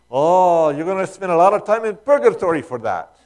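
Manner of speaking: loud, high-pitched Irish exclamation; rich Irish accent. Retold or imitated. Preacher quoting an old Irishman from a movie, rich Irish accent. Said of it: "in this rich Irish accent says".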